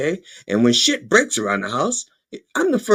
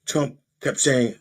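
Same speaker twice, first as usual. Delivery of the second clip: normal talking voice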